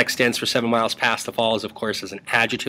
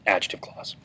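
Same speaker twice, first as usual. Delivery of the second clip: whisper; 3 semitones lower, 6 dB quieter than usual. Real, not acted. Answer given as aside.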